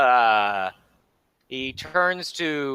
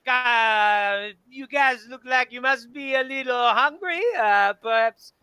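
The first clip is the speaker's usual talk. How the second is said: higher, hammed-up French bar host; French accent; 7 semitones higher than usual. Bar owner offers the hungry party food in French accent. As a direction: lay the French on thick as the host. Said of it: "he's doing too much French".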